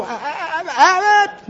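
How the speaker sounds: high, breathy comic stammer, voice cracking; panicked comic sidekick, half laughing; Lou Costello panic. Retold or imitated. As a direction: squeal it like Lou Costello scared stiff. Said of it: "like he would go".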